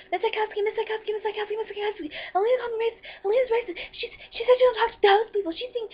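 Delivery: very high, loud, frantic imitation of a classmate; mock tattletale. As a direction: pester the teacher with a squealed, repeated complaint.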